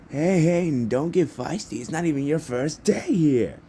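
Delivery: high, loud hype-man voice; President Mike. Acted. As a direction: call out excitedly to break up a squabble. President Mike breaks up quarrel.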